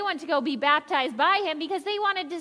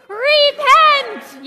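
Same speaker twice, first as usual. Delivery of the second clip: full-voiced, higher prophet's cry; shouted Repent; 11 semitones higher, 11 dB louder than usual. Shown as a demonstration. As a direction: belt it out for the whole room. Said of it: "He shouted".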